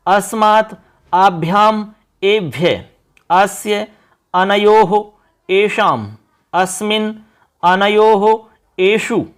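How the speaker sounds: each Sanskrit form said slowly and separately for pupils to repeat; recitation of forms. Shown as a demonstration. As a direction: recite the declension one word at a time, slowly. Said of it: "एक बार उच्चारण करते हैं".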